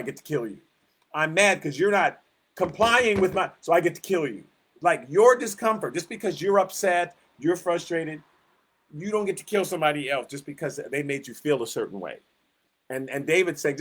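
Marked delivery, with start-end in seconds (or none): none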